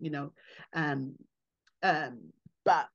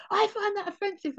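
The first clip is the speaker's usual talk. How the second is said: mock offended patron; 10 semitones higher than usual. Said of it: "heard somebody go".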